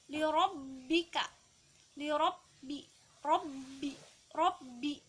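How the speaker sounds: "rabbi" with the double b pressed and held; ba ditekan, ditahan. Shown as a demonstration. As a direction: stop on the bb in "rabbi" before releasing it. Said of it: "ba bertasydid harus ditekan dan ditahan". ("rabbi" dengan ba ditekan dan ditahan)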